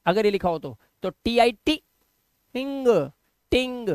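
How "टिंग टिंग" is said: "टिंग" higher, ending in a nasal NG; careful model reading of "टिंग"; NG reading demo. Shown as a demonstration. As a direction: sound out "टिंग" with half N into G. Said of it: "आप इसे क्या पढ़ोगे".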